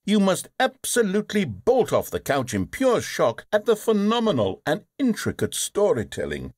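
normal talking voice

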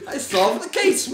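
triumphant. Pretend. Mock detective triumph.

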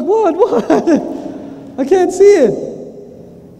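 panicked confusion. Retold or imitated. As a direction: twist round, desperate to see. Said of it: "you're like".

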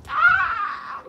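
a sharp high shout of alarm; sudden shout. Real, not acted. Gamer shouts at something in the game. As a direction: yelp out loud at the screen.

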